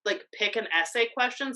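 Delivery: normal talking voice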